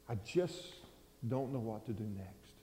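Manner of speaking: a hint of a grieving son; softly voiced despair; hushed grief.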